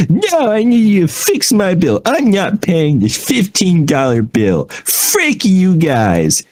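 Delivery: high, hammed-up complaining; grumpy customer. Customer refusing to pay his bill, mock grumpy. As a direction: imitate an indignant customer digging in. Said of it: "he sounded so grumpy".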